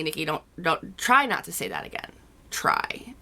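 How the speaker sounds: soft, hushed note to self; gentle self-reprimand. Acted. Creator reminding herself after offending viewers, quiet self-reprimand. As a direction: quietly talk yourself out of it, voice dropping away.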